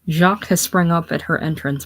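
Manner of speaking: ordinary talk, nothing marked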